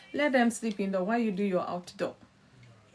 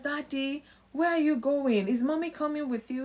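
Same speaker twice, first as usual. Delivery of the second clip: put-on child asking; 6 semitones higher than usual. Parent voicing a curious child.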